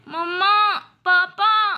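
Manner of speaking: loud, high wail for mamma and papa; a little girl wailing for help at night; crying out for parents. Acted. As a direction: call out to the parents in pain.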